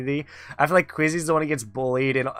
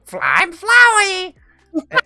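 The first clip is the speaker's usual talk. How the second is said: Toad-style Flowey voice; 7 dB louder than usual. Retold or imitated. Streamer mimicking Quizzy's game voice for Flowey, Toad-style squeal. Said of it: "Toad style voice for Flowey".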